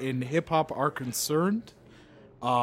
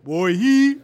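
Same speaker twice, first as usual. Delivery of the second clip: put-on Jamaican accent; 7 semitones higher, 9 dB louder than usual. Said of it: "working on my Jamaican accent".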